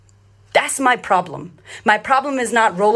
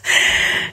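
short breathy laugh. Real, not acted. Laughing at her own story about the night, short breathy laugh. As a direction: let out a brief laugh.

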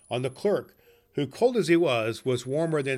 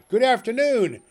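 loud, high, curt goodbye; curt dismissal; 11 semitones higher, 7 dB louder than usual. Acted. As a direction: slam the door with your voice. Scrooge dismissing again.

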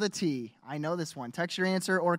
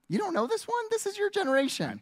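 high, mock-shocked teasing; you can't be serious, playfully; teasing disbelief; 13 semitones higher than usual. Real, not acted. Teasing cohost, pitch up.

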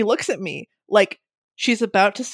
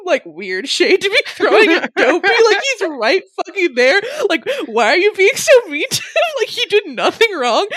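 indignant squeal; 10 semitones higher, 4 dB louder than usual. Retold or imitated. Indignant inner reaction.

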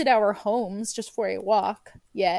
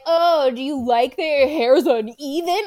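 louder mock-snide question; rude hairdresser; 3 semitones higher, 7 dB louder than usual. Hairdresser judging a client's home haircut, mock rude. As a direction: imitate a hairdresser being rude with fake interest. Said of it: "rude about it".

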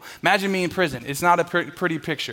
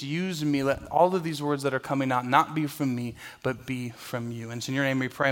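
soft, earnest prayer voice; pastor's prayer; 2 semitones lower, 4 dB quieter than usual. Acted. Pastor praying before the message, soft and earnest. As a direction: pray it humbly to God.

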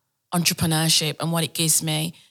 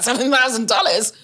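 surprised; 6 semitones higher, 5 dB louder than usual. Retold or imitated. Stunned by the money offered.

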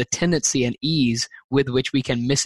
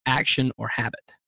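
ordinary delivery

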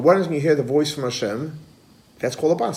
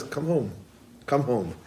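soft, gentle-volume call home; 3 semitones lower, 9 dB quieter than usual. Acted. Heavenly voice calling children home, soft call. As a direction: call out softly, dropping at the end.

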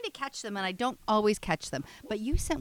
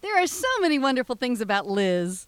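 John voice; 2 semitones higher, 7 dB louder than usual. Acted. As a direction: gush over it. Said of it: "John says".

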